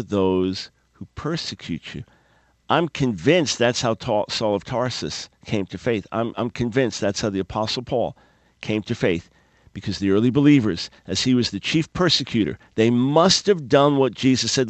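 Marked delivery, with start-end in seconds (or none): none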